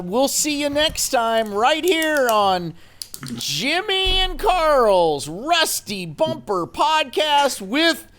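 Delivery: high, slow, showman sign-off; announcer voice. Acted. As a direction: stretch it out like a game show host. Big drawn-out show sign-off.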